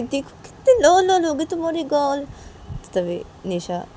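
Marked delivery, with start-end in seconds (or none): 0.0-2.2 high, loud cutesy teasing; teasing cooing. Acted. Friend pinches Nisha's red cheeks, teasing coo.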